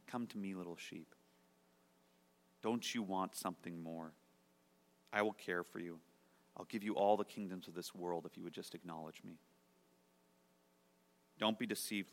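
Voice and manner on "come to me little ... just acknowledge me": soft, coaxing, much softer thief's voice; thief's seductive whisper. Acted. Thief whispering to the sheep, softly seductive. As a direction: lure the little sheep in softly. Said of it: "begins to whisper".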